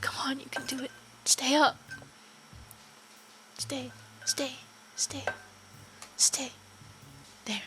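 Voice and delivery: soft hushed pleading; will the phone to stay up; coaxing whisper. Real, not acted. Streamer softly coaxing her propped-up phone.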